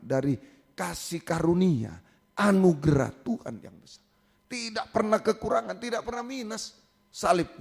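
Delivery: rising fervent preaching voice; full sermon delivery. Acted. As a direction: preach it to the congregation with conviction. Opening preaching on grace.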